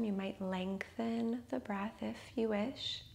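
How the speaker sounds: ordinary talk, nothing marked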